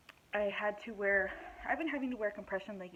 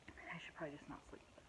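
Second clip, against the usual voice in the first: whispered aside; 3 semitones lower, 13 dB quieter, faster than usual. Real, not acted. Self-critical aside about the ring.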